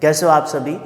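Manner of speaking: ordinary talk, nothing marked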